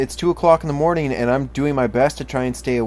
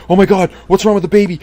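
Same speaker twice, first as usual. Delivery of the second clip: much higher and louder, hammed-up alarm; mock panic; 8 semitones higher, 10 dB louder, faster than usual. New father panicking at every contraction, hammed-up shriek. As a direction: shriek it like a panicking first-time dad. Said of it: "you're like".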